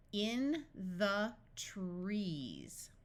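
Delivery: very slow, each word drawn out; stretched-out practice reading. Shown as a demonstration. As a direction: separate the words for a beginning reader. Practice sentence read word by word.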